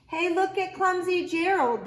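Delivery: mock him with a sneer. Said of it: "the animals all sneered".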